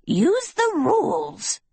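very high, stretched-out gnome voice; Yuba bellowing. A narrator's reading. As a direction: call it out slowly in a piping gnome voice. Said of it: "Yuba bellowed atop her log".

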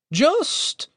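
very high, frightened stammer; shaking voice. A narrator's reading. Terrified captive answering the armed intruders, voice shaking. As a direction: squeak it out high in terror. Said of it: "his voice shook with each word".

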